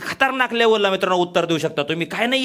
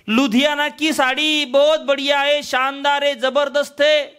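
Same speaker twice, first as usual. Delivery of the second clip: high, slower hawker boasting of the sarees; hawker pitch; 5 semitones higher, slower than usual. Retold or imitated. Hawker outside praising Ludhiana sarees to the housewife. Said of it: "बाहेरून आवाज दिला".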